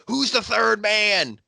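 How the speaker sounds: high, drawn-out mock question; hammed-up question. Wrestling fan playing up the third man gag, hammed-up. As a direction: stretch it out high like a catchphrase. Acting out the trophy running gag.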